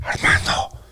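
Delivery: a ghostly voiceless whisper of the name "Armando"; eerie whisper. Retold or imitated. A voice in the dark whispering his name while he lies in bed. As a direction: breathe "Armando" like an unseen voice calling.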